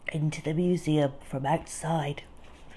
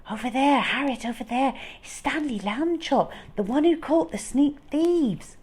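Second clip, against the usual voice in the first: mild mock whisper; 7 semitones higher than usual. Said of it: "He could hear them whisper".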